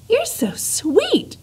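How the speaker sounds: high, slow, doting coo. Acted. Princess coos adoringly at Despero, high and slow. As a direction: coo it like adoring a tiny pet.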